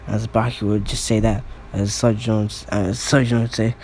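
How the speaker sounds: usual speaking voice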